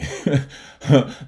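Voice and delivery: bursting out laughing, loud and high; real laugh. Real, not acted. Vlogger laughing out loud at the topic. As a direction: laugh out loud before explaining.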